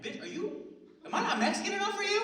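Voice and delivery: mock outrage, shooting up very high; high, loud, incredulous comic yell; indignant outburst. Boyfriend to girlfriend over role-play jobs, indignant high shout.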